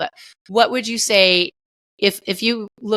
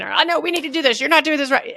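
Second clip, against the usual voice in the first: mock screaming parent; 7 semitones higher, faster than usual. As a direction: imitate a fed-up mom losing it, mildly. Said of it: "I start screaming".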